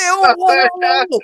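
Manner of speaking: startled whoa. Retold or imitated. Retold backing off, high pitch.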